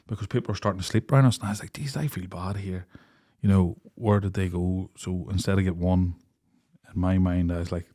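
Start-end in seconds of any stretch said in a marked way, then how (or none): 1.7-4.9 softer, slower guilty voice; quiet retold worry; hushed. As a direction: keep it down, people sleep.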